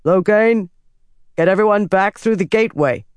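lifted, commanding call. A narrator's reading. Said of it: "he raised his voice".

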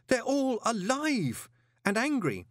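high, slow, horrified; he's scared out of his wits; panicked plea to the golem. A narrator's reading. Terrified man babbling to golem.

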